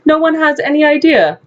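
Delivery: ordinary talk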